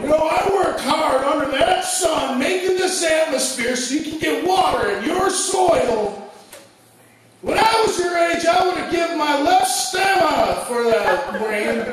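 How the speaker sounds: lecturing parent plant. Acted. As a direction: nag like an old parent.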